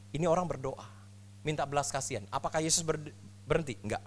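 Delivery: normal talking voice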